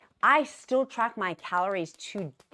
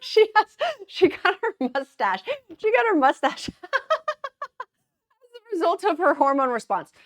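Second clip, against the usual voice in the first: high, loud giggly delivery; laughing; 13 semitones higher, 7 dB louder than usual. Real, not acted. Laughing at her own photo, high and giggly. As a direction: squeal it through real laughter.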